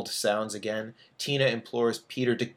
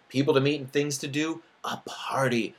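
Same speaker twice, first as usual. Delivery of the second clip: whispered; 3 semitones higher than usual. Acted. Narrator tempting Bert to party.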